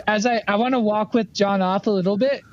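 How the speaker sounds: ordinary delivery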